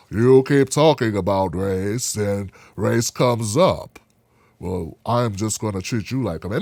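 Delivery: very low, slow, soft voice; hushed, measured deep-voiced impression; Morgan Freeman. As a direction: drop deep and draw the words out unhurriedly. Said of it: "no, Morgan Freeman".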